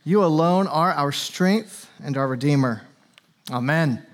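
low, earnest prayer voice. Real, not acted. Opening prayer before sermon.

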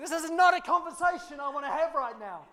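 high, loud, laughing objection; laughing protest. Retold or imitated. Pastor answering his son on the ride, laughing protest. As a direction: push back in mock horror, chuckling. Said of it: "I said".

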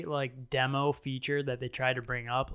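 ordinary talk

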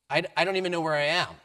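fast, loud, stumbling protest; flustered. Retold or imitated. Alan protesting to the shelter worker, fast and loud. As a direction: blurt it out in a rush of confusion.

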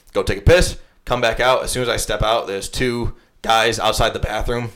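usual speaking voice

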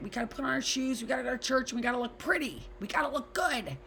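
high, pushy nagging mom voice; frazzled mom. Retold or imitated. Mother hurrying kids to church. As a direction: rush them out the door. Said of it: "I remember being like".

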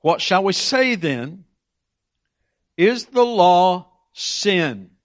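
scripture question. Acted. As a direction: drag out the question for weight. Quoting Paul's question.

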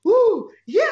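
high, loud, delighted ooh; imitated congregation cheering along; excited crowd reaction. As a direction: echo the crowd getting into it. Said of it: "They were like".

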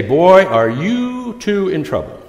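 slow, loud, high scolding dad; scolding parent. Retold or imitated. Pastor voicing a parent catching the children, mock scolding. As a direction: draw it out like a parent catching kids. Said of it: "sounds like a parent would be".

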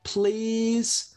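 high, drawn-out plea; hammed-up child's pleading. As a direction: beg in a long high whine. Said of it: "you plead".

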